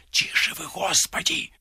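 the fortune-teller anxiously hushing her guest; hushed whisper. Acted. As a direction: whisper urgently.

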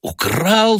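high indignant echo; shocked cry. A narrator's reading. Son cries out at being called a thief. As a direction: throw the word back in disbelief. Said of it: "вскрикнул он".

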